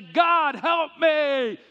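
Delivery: loud, high shout for help; desperate cry. Acted. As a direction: cry out as if falling.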